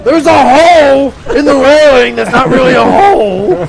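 high, loud indignant protest; exasperated yelling. Real, not acted. Player protesting the railing to friends, exasperated shout. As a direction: shout in disbelief at the game.

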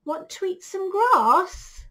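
high, loud storybook cow; cow's voice. Acted. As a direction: call out an invitation as a cow. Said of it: "said the cow".

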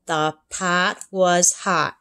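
slow, deliberate reading; careful reading. Acted. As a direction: read each word slowly. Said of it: "she read".

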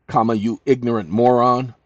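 ordinary talk, nothing marked

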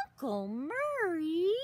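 plain reading voice

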